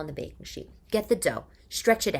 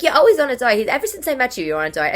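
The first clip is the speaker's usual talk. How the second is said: louder, higher teasing exclaim; teasing friend; 7 semitones higher, 9 dB louder, faster than usual. Retold or imitated. Friend Ethan teasing her about always dieting, loud mock. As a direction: rib her like an old friend. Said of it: "He's like".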